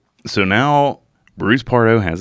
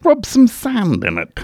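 pitched-up mock Australian, leaning in; Australian accent; 13 semitones higher than usual. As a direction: belt it out like a keen Crocodile Hunter. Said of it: "My Australian accent's fucking terrible".

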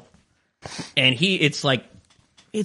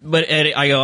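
usual speaking voice

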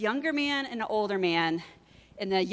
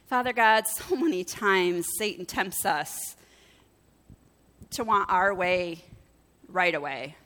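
prayer. Real, not acted. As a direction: lead a group gently in prayer. Leads closing prayer.

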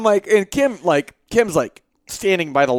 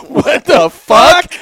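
slow, high, loud mock shock; astonished; 8 semitones higher, 8 dB louder, much slower than usual. Wife reacting to her husband's threat, imitated as astonished. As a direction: drag it out in appalled disbelief.

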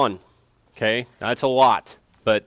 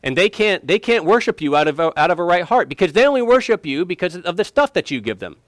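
raised, lightly acted accusing voice; higher, emphatic imitation of an accuser; Satan's accusation; 6 semitones higher, 4 dB louder than usual. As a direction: argue it hard, as the accuser before God. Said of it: "'Cause he comes and says".

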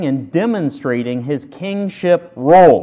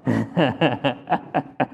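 short chuckle; 6 semitones lower, 8 dB quieter than usual. Real, not acted. Chuckle at own joke.

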